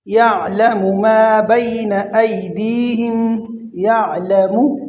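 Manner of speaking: slow recitation, یعلم ending in short mu; pesh on meem. Shown as a demonstration. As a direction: recite یعلم as ya'lamu, vowels drawn out. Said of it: "میم پر پیش پڑھیں گے". (میم پر پیش، ٹھہر کر)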